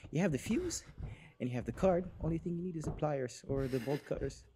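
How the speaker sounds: soft, hushed instructions to the character. Acted. Player quietly coaching his character Chance to hide, hushed. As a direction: keep it down so the monster won't hear. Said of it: "Stop doing that voice".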